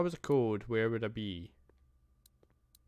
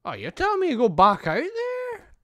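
outraged at being sent back; indignant disbelief; 12 semitones higher, 8 dB louder than usual. Real, not acted. Protesting game's order to go back.

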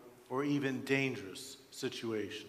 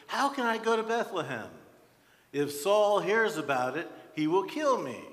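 higher, louder, urgent objection; Samuel's alarmed protest; 6 semitones higher, 5 dB louder than usual. Acted. Samuel tells God that Saul will kill him, protesting loudly. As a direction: protest emphatically, pitch raised throughout.

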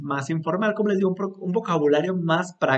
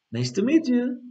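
higher, fluid "nice to meet you"; joined up; 8 semitones higher than usual. Shown as a demonstration. Teacher repeating the phrase at speed, joined and higher. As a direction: run it together lightly on a raised pitch. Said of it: "lo puedes como juntar".

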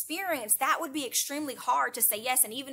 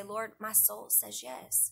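heartfelt prayer; slower than usual. Shown as a demonstration. Speaker models a personal yes to the Lord, slow prayer. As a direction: pray it slowly, letting each yes sink in. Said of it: "we all have to come to that point where we say".

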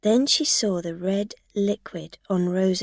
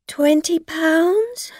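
hesitant; 9 semitones higher, 8 dB louder, slower than usual. A narrator's reading. Driver hesitantly offering bribe.

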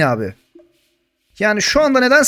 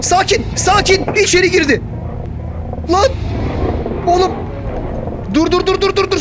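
higher, urgent pleas to calm down; panicky calming; 11 semitones higher than usual. Real, not acted. Gamer begging dinosaurs in his house to stop, high panicky pleading. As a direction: plead with the beasts, voice pitched up in alarm.

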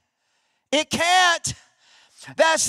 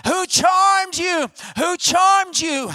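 high, slow, drawn-out demand; intense challenge; 4 semitones higher, much slower than usual. Acted. Paul challenging the bewitched Galatians, intense. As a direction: preach it hard, stretching every word. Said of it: "he says that with more intensity".

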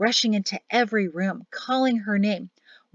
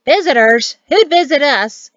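put-on loud, puzzled old person woken up; raised voice, higher on the question; loud exchange; 5 semitones higher, 10 dB louder than usual. A narrator's reading. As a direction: call it out in loud disbelief. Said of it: "After some loud exchanges of".

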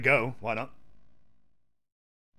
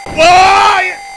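loud, high wail of a question; long high shout; shouted protest; 11 semitones higher, 12 dB louder than usual. Real, not acted. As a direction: cry out at the injustice. Crying out at game mishap.

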